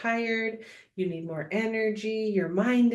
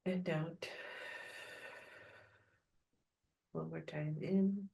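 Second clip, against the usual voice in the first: calm grounding exercise; 6 dB quieter than usual. Acted. Leads a meditation.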